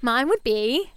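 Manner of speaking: ordinary talk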